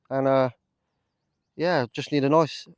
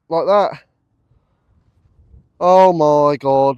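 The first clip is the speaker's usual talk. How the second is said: loud, slow drawn-out gasp of wonder; amazed; 9 dB louder, much slower than usual. Real, not acted. Detectorist gapes at the big coin, slow and loud. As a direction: stretch it in awe.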